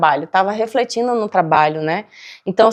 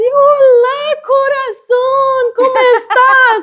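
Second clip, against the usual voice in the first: much higher, slow, sing-song greeting; 13 semitones higher, 4 dB louder, much slower than usual. Retold or imitated. The cashier now greeting her, much higher and slow. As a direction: coo it out like a transformed sweet clerk. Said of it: "essa mulher abriu a janela e disse".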